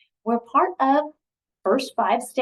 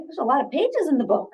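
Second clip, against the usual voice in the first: ordinary delivery, nothing marked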